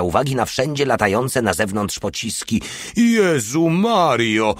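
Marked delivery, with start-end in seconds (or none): 3.0-4.5 much higher, louder, slow joyful cry; joyful call. Acted. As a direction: call out in sheer delight. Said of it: "zawołał radośnie". (radosny, dużo wyższy i głośniejszy okrzyk)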